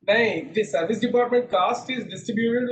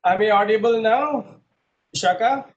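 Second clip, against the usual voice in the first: louder, calling across the call; raised voice; 4 semitones higher, 6 dB louder than usual. Real, not acted. Teacher checking if students can hear him, louder. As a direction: pushes the voice out so the class can hear. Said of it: "My voice is very low".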